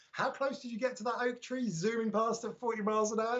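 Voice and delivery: mock-astonished viewer; amazed viewer. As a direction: imitate someone gasping at a near miss. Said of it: "you're like".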